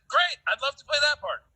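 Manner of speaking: high, loud, gushing yes; eager acceptance. Retold or imitated. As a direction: re-enact jumping at the part. Eager actor retelling his second reply.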